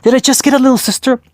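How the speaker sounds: high, fast, thrilled question; excited. A narrator's reading. Girl thrilled to get a little sister, excited. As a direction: burst out with childish delight.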